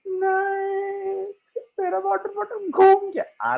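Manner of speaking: put-on high whiny child's wail; crying little girl. Girl telling her papa, high tearful whine. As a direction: whimper it like a sulking little girl. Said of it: "उसने कहा".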